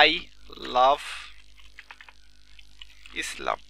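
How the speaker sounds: slow, halting, with long gaps between words. Shown as a demonstration. Teacher writing an example for students, slow word by word. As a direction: dictate it slowly while writing it out.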